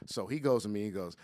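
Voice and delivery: normal talking voice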